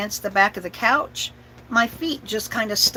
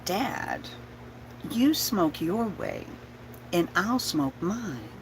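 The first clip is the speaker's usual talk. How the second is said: slow, much softer and unbothered; cool deadpan; 8 dB quieter, much slower than usual. Retold or imitated. Little daughter answering her father, quiet and slow. Cool kid reply to dad.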